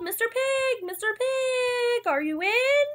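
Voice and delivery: high, sustained sing-song call; high call ending on a questioning lift. Acted. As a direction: hail a neighbor through the door, stretching it out. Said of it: "and I called".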